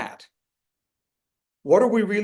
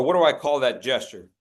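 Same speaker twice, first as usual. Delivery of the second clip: ordinary talk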